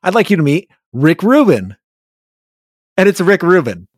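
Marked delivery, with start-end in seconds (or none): 0.0-1.8 upbeat introducing call. Retold or imitated. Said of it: "your manager goes".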